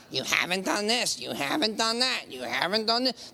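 nagging, repetitive accusation; condemning devil. Retold or imitated. Devil accusing the listener of failing, condemning. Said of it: "That's a voice of condemnation".

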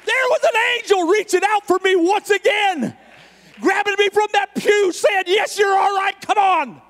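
high, sustained preacher's cry; fervent preaching. Real, not acted. Preacher telling somebody tonight God cares, fervent preaching. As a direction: proclaim it at full force, high and drawn out.

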